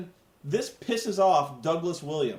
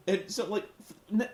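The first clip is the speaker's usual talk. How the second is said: ordinary delivery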